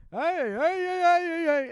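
high, loud holler of repeated heys; piercingly high mock yell; imitated yelling. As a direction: mimic the neighbor yelling at them. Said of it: "she, like, yells at us".

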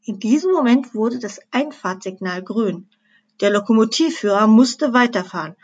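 usual speaking voice

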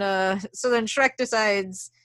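normal talking voice

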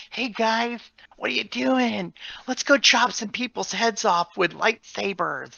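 high-pitched, put-on little boy; little kid voice. Fan showing the voice actor how it's done, high kid voice. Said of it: "didn't have the ability to".

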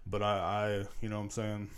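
normal talking voice

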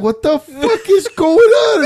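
high, loud, drawn-out alarmed yell. Retold or imitated. Dad hearing screams at home, high alarmed yell. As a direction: bellow it as you run out. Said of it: "And then I'm like".

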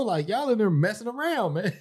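put-on high, whiny nagging girlfriend; Mariah's nagging voice. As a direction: nag like an annoyed girlfriend. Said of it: "She be saying all this".

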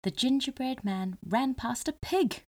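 ordinary delivery

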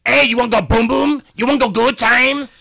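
loud, high-pitched rough hawker imitation; raspy come-on. Street solicitor trying to lure a customer, raspy and loud. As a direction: bellow a crude seduction with rasp. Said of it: "the bass and rasp out of your voice".

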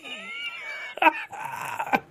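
long, very high squeal of laughter; high-pitched laugh. Real, not acted. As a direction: crack up in a soft high squeal. Cracks up at own Buffalo remark.

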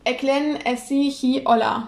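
"Erklären es Sie hi Olla" spoken as the sentence reversed; backwards speech. Shown as a demonstration. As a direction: say "Hallo, ich heiße Nelke" backwards, word by word. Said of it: "Magst du es mal vormachen?".

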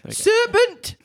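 very high falsetto screech; a hammed-up Geordie pigeon shrieking; screaming pigeon. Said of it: "screamed the pigeon".

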